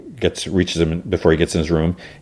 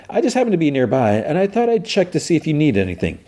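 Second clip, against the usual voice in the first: eager assistant; 10 semitones higher than usual. Retold or imitated. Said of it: "Vincent's like".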